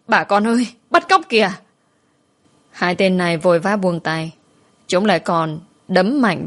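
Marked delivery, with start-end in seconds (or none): 0.1-1.5 very high, loud alarm call; passers-by shouting an alarm at the kidnappers. A narrator's reading. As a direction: yell to the whole street. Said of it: "họ vội vã hét lớn".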